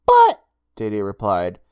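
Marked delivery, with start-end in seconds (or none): 0.1-0.4 put-on high boyish voice, panicked; panicky boy. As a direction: blurt it out in a high boy's voice.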